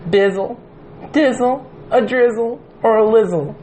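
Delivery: high, sing-song [name] rhymes; mock squeaky [name] voice. Retelling the [name] meme, high sing-song voice. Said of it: "And they're like".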